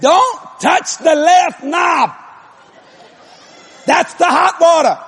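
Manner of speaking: slow, high holler of warning. Retold or imitated. Husband warns wife off the hot knob, hollering. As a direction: holler each word up through the floor. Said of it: "I'm hollering this through the floor".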